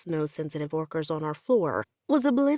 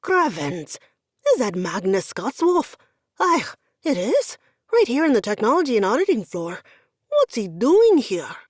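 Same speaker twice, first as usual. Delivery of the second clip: exclaiming; 3 semitones higher, 7 dB louder than usual. Acted. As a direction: gush at the famous visitor. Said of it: "he broke off to exclaim".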